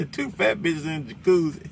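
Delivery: quoting through laughter. Retold or imitated. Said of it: "he was like".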